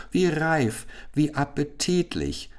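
high, delighted royal exclamation; king calling out. A narrator's reading. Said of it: "rief der König".

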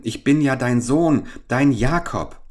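high, urgent plea of a son; pleading. A narrator's reading. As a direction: beg your mother to recognise you. Son pleads; mother later mimics him.

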